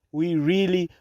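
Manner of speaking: ordinary delivery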